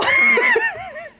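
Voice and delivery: high burst of real laughter; shrieking laugh at chat's reaction; laughing fit. Real, not acted. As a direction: break into helpless laughter.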